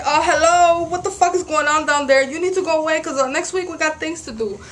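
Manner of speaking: loud, high, laughing scold; mock scolding her period. Real, not acted. Woman laughingly scolds her own period, loud and high.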